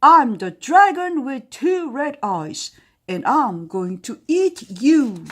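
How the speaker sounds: very loud, high all-out dragon roar; biggest roar. Acted. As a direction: give it your biggest roar ever. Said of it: "He gave his biggest roar".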